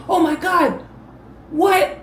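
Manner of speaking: picturing Logan reacting to the find, mock amazement; imitated amazement. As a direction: play the stunned friend. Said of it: "he's gonna be like".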